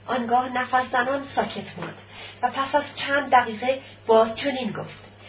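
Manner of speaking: usual speaking voice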